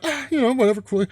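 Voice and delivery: high, offhand mock shrug; put-on dismissive noncommittal tone; imitated indifference. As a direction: toss it off in a high voice. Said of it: "it was just kinda like".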